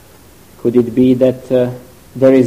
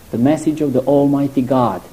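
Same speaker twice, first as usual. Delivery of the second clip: ordinary talk, nothing marked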